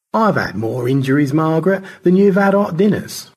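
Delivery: lightly voiced boaster, rising in pitch; George, boastful. Rescued astronaut boasting to Margaret, lightly hammed-up bravado.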